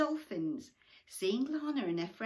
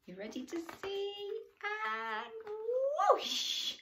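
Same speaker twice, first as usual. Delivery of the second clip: much higher, sing-song; high excited voice; 5 dB louder than usual. Real, not acted. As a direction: ask it up high and eager.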